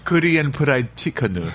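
Korean fried chicken in a slow Korean accent. Shown as a demonstration. Asked by the host, a guest says his order in a Korean accent. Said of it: "I'm gonna make you say it with the accent".

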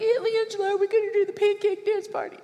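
put-on high, quick excited child voice; eager little kid. Young nephews pleading with their aunt, high and eager. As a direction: bounce up high like a kid begging for a treat. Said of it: "nephews and nieces that are younger and like".

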